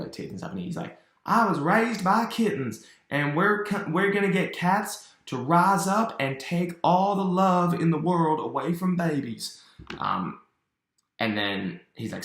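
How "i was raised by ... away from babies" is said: high, loud cartoon madman voice; unhinged villain. Cat-raised villain reveals his plan, unhinged high voice. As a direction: proclaim the scheme in crazed high pitch. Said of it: "This guy's really unhinged".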